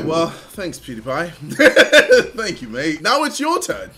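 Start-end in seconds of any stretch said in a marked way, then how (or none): 3.0-3.9 high-pitched taunt through laughter; gleeful taunt. Real, not acted. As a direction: tease your rival while cracking up. Gleefully passing challenge to rival.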